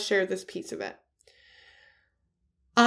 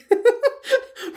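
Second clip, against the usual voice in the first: short amused laugh; 14 semitones higher, 3 dB louder than usual. Real, not acted. Laughing at own naivety.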